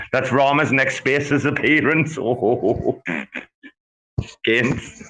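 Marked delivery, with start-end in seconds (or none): none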